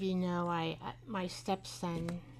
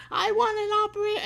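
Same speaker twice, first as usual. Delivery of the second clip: mock yell, much higher and louder, rushed fast; hammed-up angry demand, rattled off quickly; louder husband imitation; 12 semitones higher, 10 dB louder, much faster than usual. Husband losing patience with a phone robot, fast mock yell. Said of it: "his voice gets louder and louder".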